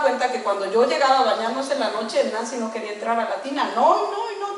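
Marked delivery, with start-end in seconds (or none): 3.7-4.6 high-pitched mock protest; imitated child's refusal. Little girl refusing the bathtub, high mock protest.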